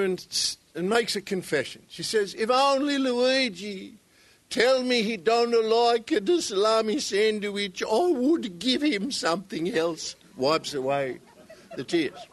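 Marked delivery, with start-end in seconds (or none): 2.3-10.1 little Italian widow. Acted. Italian widow confesses at funeral.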